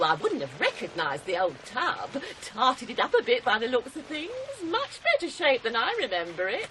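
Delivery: ordinary talk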